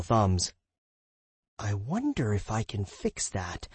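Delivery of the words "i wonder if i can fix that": soft, slow wondering aloud; quiet musing. Acted. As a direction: muse to yourself, drifting off. Musing aside to self.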